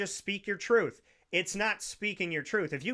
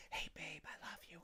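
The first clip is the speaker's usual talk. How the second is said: very soft whispered aside; hushed cinema whisper; 21 dB quieter than usual. Acted. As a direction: whisper sweetly to your partner mid-film. Example of acceptable quiet cinema talk.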